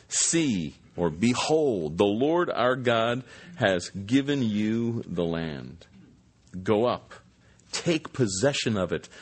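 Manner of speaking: formal Bible reading voice; scripture reading. Acted. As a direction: read the passage with weight, evenly. Reads scripture passage aloud.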